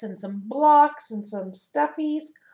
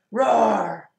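a playful, louder roar from the little tiger; 7 dB louder than usual. Acted. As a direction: roar like a cuddly tiger cub for the kids. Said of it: "And he says".

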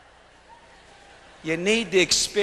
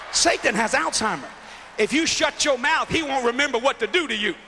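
full-force preaching; 8 semitones higher than usual. Acted. Preacher to the arena, preaching at full force. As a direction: preach it hard to the crowd.

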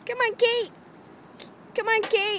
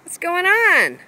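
ordinary talk

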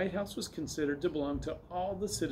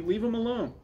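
high, scolding call; 7 semitones higher than usual. A narrator's reading. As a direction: call off a brother, lightly. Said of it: "called Willie".

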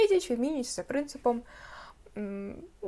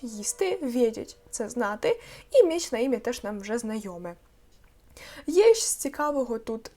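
ordinary talk, nothing marked

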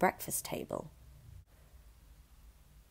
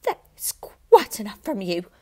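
louder, slow stern warning; sharp rebuke; 4 semitones higher, 8 dB louder, slower than usual. A narrator's reading. Mother silencing Matilda, stern. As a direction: shut her down firmly. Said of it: "Missus Wormwood said".

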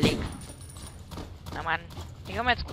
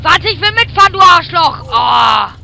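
loud, high angry yell; 9 semitones higher, 17 dB louder, slower than usual. Real, not acted. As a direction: yell it at a teammate. Gamer yells at teammate.

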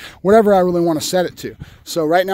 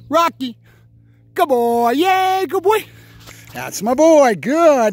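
high, louder happy dog praise; excited praise; 8 semitones higher, 3 dB louder, slower than usual. Real, not acted. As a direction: cheer him on like he won.

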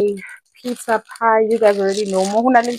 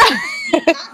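high shrieking laugh. Real, not acted. Joking with friends at brunch, shrieking laugh. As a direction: burst of squealing laughter.

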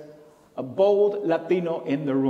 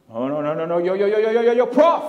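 protesting student; 3 semitones higher, 7 dB louder, faster than usual. Retold or imitated. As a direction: interrupt like an alarmed New York student. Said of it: "And he goes".

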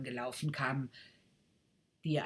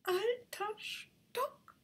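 very slow, much higher, disbelieving; offended rocket; 3 dB quieter, much slower than usual. Acted. Rocket repeats the boy's words in slow disbelief. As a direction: savour the insult slowly.

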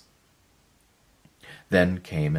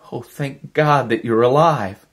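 high, loud cry of relief; relieved cry; 8 semitones higher, 7 dB louder than usual. Acted. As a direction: burst out in relief at finding him alive. Helen finds Miller alive.

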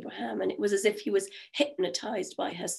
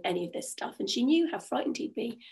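usual speaking voice

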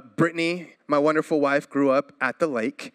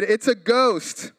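mild fearful cry; 5 semitones higher, slower than usual. A narrator's reading. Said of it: "cried out in fear".

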